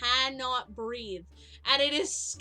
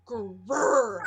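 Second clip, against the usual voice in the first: dog growl imitation; 3 semitones lower, 4 dB louder than usual. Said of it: "he'll just go".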